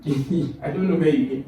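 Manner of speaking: usual speaking voice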